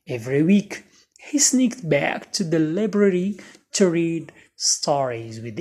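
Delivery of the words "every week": plain reading voice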